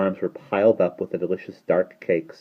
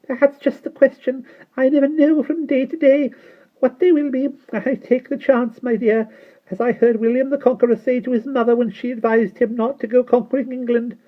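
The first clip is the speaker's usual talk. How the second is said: put-on very high, louder little-old-woman voice; tiny old shopkeeper; 7 dB louder than usual. A narrator's reading. Old shopkeeper boasting to the children, high and chatty.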